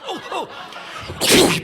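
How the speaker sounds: high "oh" groans; imitated pained wince. Just thinking about the fall, high pained groans. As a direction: wince aloud in sympathy pain. Wincing at imagined painful fall.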